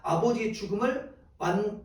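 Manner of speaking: ordinary talk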